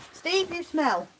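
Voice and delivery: high teasing voice; pet talk. Real, not acted. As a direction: tease the sheep fondly. Teasing the sheep.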